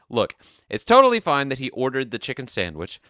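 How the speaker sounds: ordinary delivery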